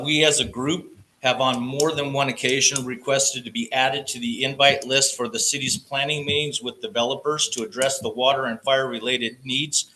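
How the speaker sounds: usual speaking voice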